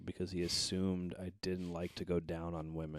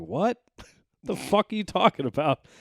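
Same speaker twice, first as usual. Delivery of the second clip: shocked disbelief; 12 semitones higher, 11 dB louder than usual. Retold or imitated. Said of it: "I was like".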